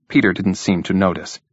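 plain reading voice

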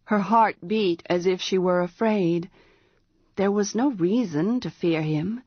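ordinary delivery, nothing marked

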